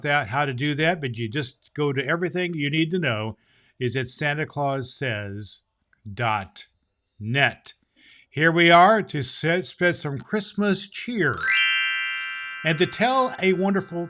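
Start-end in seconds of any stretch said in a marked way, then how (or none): none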